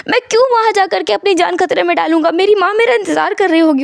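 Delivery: high-pitched, insistent protest; agitated patient. Acted. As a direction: argue urgently, refusing to stay. Wang insists he must leave hospital.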